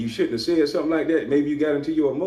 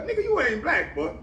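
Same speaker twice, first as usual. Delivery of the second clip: squeaky mock outrage, much higher; 11 semitones higher than usual. Mocking the podcaster's hair in a high, laughing gossip voice. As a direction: lampoon the guys gossiping about his hair.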